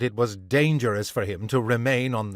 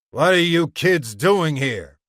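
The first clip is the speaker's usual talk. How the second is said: louder, higher challenge to intruders; gruff demand; 5 semitones higher, 5 dB louder than usual. A narrator's reading. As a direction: demand it forcefully of the kids. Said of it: "he demanded".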